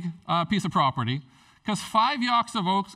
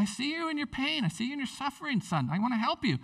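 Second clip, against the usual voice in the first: tender voice of God; 8 semitones higher, 3 dB quieter than usual. Acted. Voicing God's gentle call.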